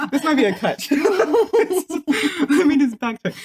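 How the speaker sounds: slow, high laughing repeat. Real, not acted. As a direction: laugh it out slowly. Laughs redoing the intro.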